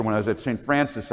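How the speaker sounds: ordinary talk, nothing marked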